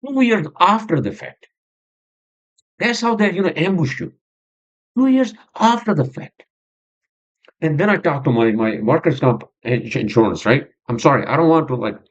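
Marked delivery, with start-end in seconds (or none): 0.1-6.4 high, exasperated complaint; frustrated. Real, not acted. Podcaster complaining of being ambushed years later, frustrated. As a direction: protest it in a raised, worked-up pitch. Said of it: "I'm just showing my frustration".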